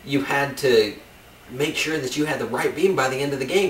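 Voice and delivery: normal talking voice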